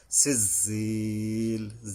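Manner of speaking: "সিজিল" with the second i held long; slow, sustained "সিজিল"; long madd. Shown as a demonstration. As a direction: chant "সিজিল", stretching the last vowel. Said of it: "ইয়ার উপরে তিন আলিফ টাইনা".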